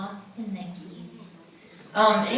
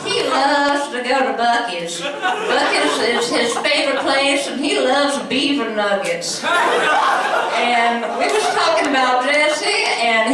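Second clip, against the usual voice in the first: mock Buc-ee's regular; slow, laughing small-town hang-out character; Jesse; 4 semitones higher, slower than usual. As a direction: make fun of a gas-station regular, drawn out. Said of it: "it's my Buc-ee's voice".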